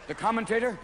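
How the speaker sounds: normal talking voice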